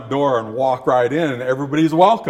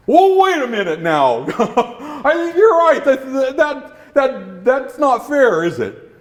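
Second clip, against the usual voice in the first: dog voice; 8 semitones higher than usual. Acted. Dog agrees, laughing.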